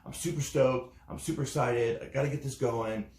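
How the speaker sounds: mock stoked enthusiasm; imitating excited friend. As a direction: play the buddy gushing with hype. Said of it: "He's like".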